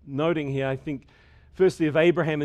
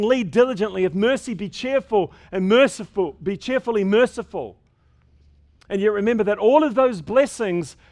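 higher and louder, driving each if and then home; fervent preaching; 5 semitones higher, 7 dB louder than usual. Acted. Preacher urging the congregation to use their gifts, fervent preaching. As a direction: preach it to the rafters. Preaching exhortation at full force.

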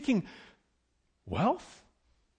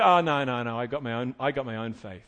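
dismissive listener; 6 semitones lower than usual. Retold or imitated. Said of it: "And you go".

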